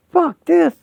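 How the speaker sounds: high, loud outburst of refusal; furious shout. Acted. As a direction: yell it out in angry protest. Angry refusal after the jump.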